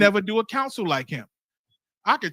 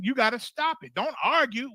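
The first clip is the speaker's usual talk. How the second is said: ordinary talk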